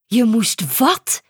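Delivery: slow, incredulous mother; a hint of a mother who can't believe it; mild disbelief. A narrator's reading. Mother repeating what her daughter said, slowly and in disbelief.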